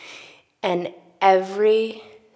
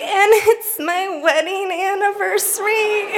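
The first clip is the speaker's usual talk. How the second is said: tearful crescendo; 12 semitones higher, 4 dB louder than usual. Retold or imitated. Climax of her list of woes.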